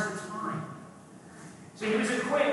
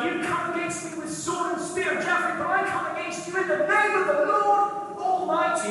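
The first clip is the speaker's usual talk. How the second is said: higher, bold defiant challenge; David's defiance; 7 semitones higher than usual. Acted. David shouting defiance at the Philistine giant.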